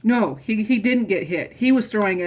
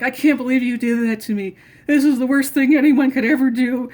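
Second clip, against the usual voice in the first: louder, rising indignant outburst; mad brother; 4 semitones higher, 7 dB louder than usual. Retold or imitated. Brother hit with an egg, loud and indignant. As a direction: rant like a sore loser just hit with an egg. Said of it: "he was so mad".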